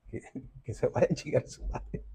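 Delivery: a breathy whispered aside in Spanish, nearly voiceless; a whispered joke to the audience about cheaters, under the breath. Real, not acted. Cheeky whispered aside about cheaters.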